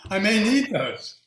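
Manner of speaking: hammed-up indignation, half laughing; demanding the torn curtains back from cousin Freddie, hammed-up outrage; mock outraged demand. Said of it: "I was like".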